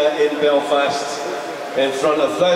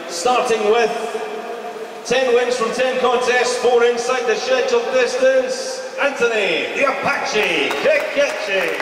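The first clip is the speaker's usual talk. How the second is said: higher, drawn-out ring announcer call; ring announcer introduction; 6 semitones higher than usual. Acted. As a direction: build the boxer's intro like a fight-night MC.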